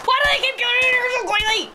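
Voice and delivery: high, fast, flustered protest; flustered, rushed and high-pitched; panicked complaint. Real, not acted. Police burst in during game.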